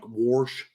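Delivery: "warsh" with an r slipped in, warsh rhyming with marsh; mom's Indiana pronunciation. Retold or imitated. Imitating his Indiana mom saying "warsh" with an r. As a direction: say "warsh", adding an r before the sh. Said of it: "she says words like".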